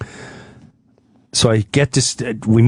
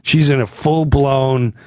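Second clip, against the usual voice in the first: normal talking voice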